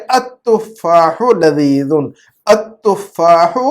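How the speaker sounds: التفاح drawn out as "at-tuffaahu"; silent lam. Shown as a demonstration. Teacher showing the vanished lam, التفاح slowly as "at-tuffaahu". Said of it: "لام کی آواز غائب کر دیا ہم نے".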